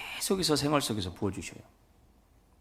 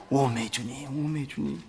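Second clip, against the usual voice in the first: higher, sighing Jeolla-dialect cry; 5 semitones higher, slower than usual. Retold or imitated. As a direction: imitate an old Jeolla mother moved to tears. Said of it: "전라도 말로". (전라도 사투리로 감탄하는 어머니 흉내)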